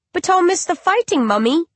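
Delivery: put-on high, innocent little child asking; a small uncanny baby calling its mother. Undead baby asks its grieving mother, high and childlike.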